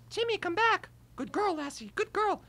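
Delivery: high, loud calling out to Timmy and the dog; hammed-up TV mom calling her collie; Lassie mom impression. Boy imitates the mom on Lassie for Rachel, high and calling. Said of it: "the mom on Lassie".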